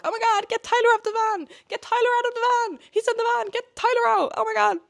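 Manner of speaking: frantic high-pitched screaming; loud, high cries of alarm; panicked crowd shouting. Acted. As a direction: yell like a bystander at a crash. Bystanders panicking at the crash.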